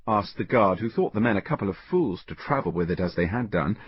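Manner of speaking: usual speaking voice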